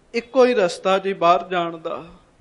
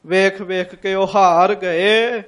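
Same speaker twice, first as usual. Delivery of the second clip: weary drawn-out telling; 3 dB louder, slower than usual. Acted. Preacher dramatizing weary searching.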